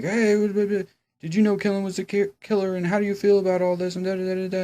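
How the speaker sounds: high, fast, pushy mock reporter; a hammed-up nosy reporter firing questions. Recapping the show, mocking reporters swarming Emma. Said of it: "the media is all like".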